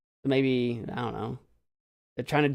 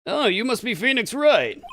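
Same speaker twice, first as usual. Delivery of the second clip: higher-pitched attempt at Acro's voice; trying out a voice; 8 semitones higher, 4 dB louder than usual. Shown as a demonstration. Gamer testing another voice for Acro, trying it out. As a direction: pitch the trial voice up. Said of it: "I gotta have a voice for you".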